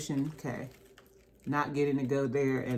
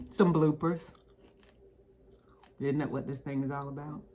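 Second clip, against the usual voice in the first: normal talking voice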